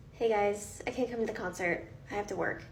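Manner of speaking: soft, subdued and let down; dejected. Acted. Worker telling friends the concert is off, softly dejected. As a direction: back out quietly, resigned. Cancelling plans after boss demands shift.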